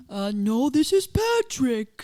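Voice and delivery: loud, dopey cartoon voice; slow goofy cartoon character impression; Patrick. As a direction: answer like a dim cartoon starfish. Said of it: "I actually think I have a pretty good Patrick impression".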